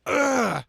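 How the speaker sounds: loud high grunt of pain. Acted. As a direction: take the punch with a grunt. Hero hit by rocket.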